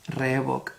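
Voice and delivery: rebook said reh-bok with pure vowels; Spanish vowels. Shown as a demonstration. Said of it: "E, O, O".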